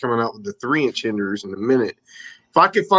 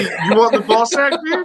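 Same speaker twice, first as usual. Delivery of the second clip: mock jokey voice; 9 semitones higher than usual. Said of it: "I was about to say".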